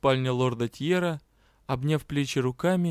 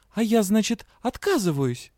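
higher, louder angry retort; angry question; 7 semitones higher, 4 dB louder than usual. A narrator's reading. Heroine throws his words back at the magister angrily. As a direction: snap back at him, offended. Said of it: "зло спросила".

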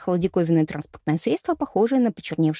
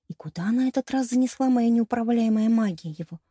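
ordinary delivery, nothing marked

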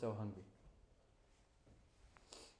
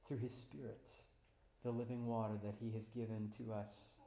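ordinary delivery

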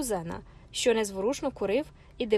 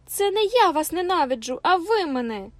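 higher, louder, tearful cry; bursting into tears; 6 semitones higher, 6 dB louder than usual. A narrator's reading. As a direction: break into tears as you accuse him. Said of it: "розридалася Катрина".

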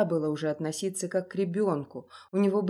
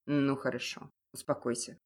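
tense; 6 semitones lower, 4 dB quieter than usual. A narrator's reading. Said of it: "напряжённо заметил Невинский".

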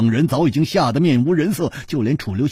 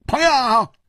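red-faced, push back hard; a flustered man defending his hosts, high and strained; indignant outburst; 13 semitones higher than usual. Acted. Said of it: "涨红了脸说".

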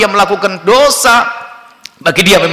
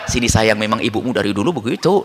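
doting father; 9 semitones lower, 5 dB quieter than usual. Acted. Soft father undercutting strict mother.

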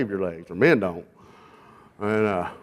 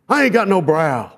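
much higher mock-indignant outburst; acted outraged complaint; 8 semitones higher, 4 dB louder than usual. Groom phones his friend on wedding day, acted outraged complaint. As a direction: blurt it as the aggrieved groom.

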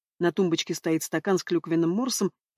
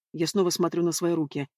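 ordinary delivery, nothing marked

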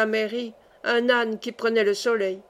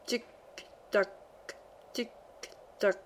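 clock ticking; 11 dB quieter, much slower than usual. A narrator's reading. Imitating the big clock ticking.

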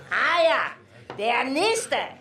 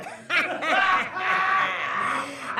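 a big, booming host laugh; hearty laugh; 6 semitones higher than usual. Acted.

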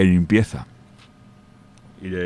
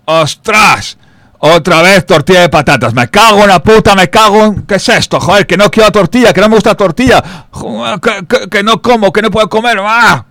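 much higher and louder, fast ranting; hammed-up furious rant; 10 semitones higher, 9 dB louder than usual. A builder ranting at his lunch, hammed-up fury. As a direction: explode at the sandwich in mock rage. Worker rants at his omelette sandwich.

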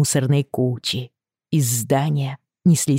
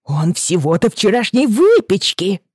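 indignant shopkeeper; 6 semitones higher, 3 dB louder than usual. Acted. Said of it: "задрожала от негодования".

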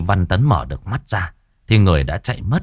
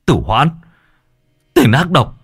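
building to a high, loud enraged shout; furious shout; 8 semitones higher, 4 dB louder than usual. Acted. Son shouting at his father's killer, rising furious roar. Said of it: "thì cậu lập tức gào lên".

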